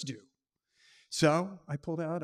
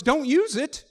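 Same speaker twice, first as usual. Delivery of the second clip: higher, louder sharp warning; urgent warning; 9 semitones higher, 8 dB louder than usual. Retold or imitated. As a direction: blurt it as an urgent order. Operator warns firmly.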